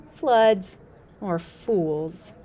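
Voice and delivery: usual speaking voice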